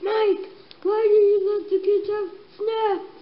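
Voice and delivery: high, loud, insistent questioning; acted scolding.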